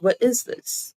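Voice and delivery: "What is this?" lifted high at the end; question inflection. Shown as a demonstration. Teacher showing how English questions go up, "What is this?" rising. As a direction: let the pitch climb through "What is this?" as a question. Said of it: "Our voice kind of goes up".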